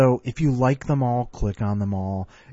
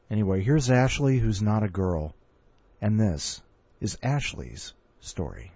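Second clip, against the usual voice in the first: ordinary talk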